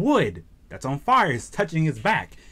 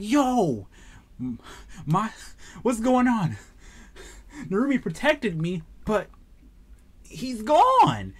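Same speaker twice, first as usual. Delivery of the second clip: put-on panicked child; 6 semitones higher, slower than usual. Kid finds only the arm.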